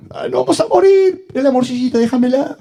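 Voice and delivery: ruego agudo y exagerado, en burla; creyente lastimero. Acted. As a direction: suplica con vocecita chillona de parodia. Parodia al creyente apegado a la comida. (lloriqueo fingido por un capricho)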